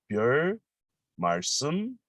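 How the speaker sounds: 별 and 말씀을 split apart, said slowly; slow pronunciation. Shown as a demonstration. Teacher pronouncing 별 말씀을 slowly for learners. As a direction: spell out 별 ... 말씀을 word by word. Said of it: "let me pronounce slowly".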